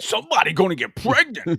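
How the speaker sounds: hammed-up comedian impression; Tracy Morgan. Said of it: "impersonation of him".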